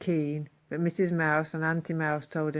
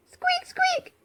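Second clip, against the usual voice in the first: high squeak, then slow eager wondering; excited mouse squeak; 4 dB louder than usual. Acted. Missus Mouse delighted in the kitchen, high excited squeak.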